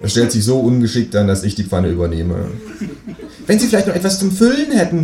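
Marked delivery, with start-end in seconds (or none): 3.5-5.0 much higher chatty kangaroo neighbour; a cheeky kangaroo asking for eggs. Acted. Kangaroo voiced in read story. (das Känguru bittet um Eier, mit hoher Stimme)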